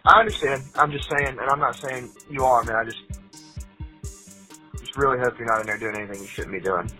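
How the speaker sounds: payphone call. Real, not acted. Jail phone call played.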